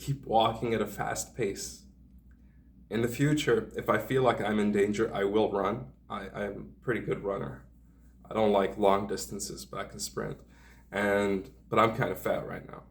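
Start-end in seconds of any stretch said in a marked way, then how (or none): none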